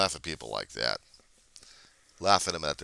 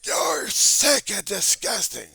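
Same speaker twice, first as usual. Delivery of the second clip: high, loud, slow sneering heckler voice; mock heckler; 10 dB louder, much slower than usual. Voicing a heckler who insults him, mocking voice. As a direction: jeer it like a hostile heckler.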